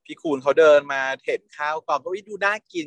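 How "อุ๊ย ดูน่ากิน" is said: high, delighted little gasp at the food; cooing over food. Retold or imitated. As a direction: coo like the dish is irresistible. Drooling over set food.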